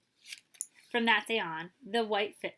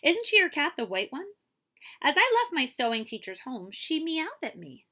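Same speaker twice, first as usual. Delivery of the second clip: put-on higher, bright young woman explaining; 7 semitones higher, 7 dB louder than usual. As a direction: speak as the charmed young lady.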